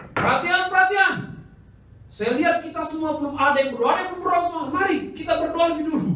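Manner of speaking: high, loud call to silence the table; loud call for attention. Retold or imitated. Preacher calling restaurant diners to pray, loud and high. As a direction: rap the table and call everyone to order.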